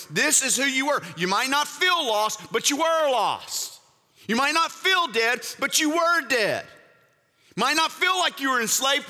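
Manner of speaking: high, urgent preaching push; fervent preaching. Acted. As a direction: drive it home to the congregation. Preaching at full force.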